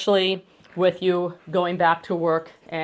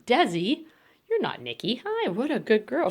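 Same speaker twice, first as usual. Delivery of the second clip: higher, sing-song praise for a good dog; 6 semitones higher, 3 dB quieter than usual. Real, not acted. Trainer praising her dog Desi in a higher sing-song. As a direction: lift your voice to praise the puppy.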